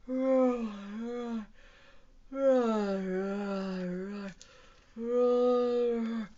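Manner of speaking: drawn-out, drowsy dinosaur roars; sleepy roar. Acted. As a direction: roar sleepily, letting each one sag. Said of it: "Let's roar sleepily".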